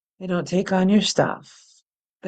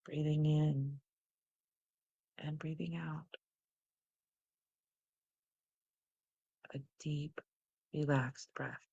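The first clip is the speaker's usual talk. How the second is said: guided meditation. Acted. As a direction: lead the breath in and out, calm and unhurried. Leads breathing exercise.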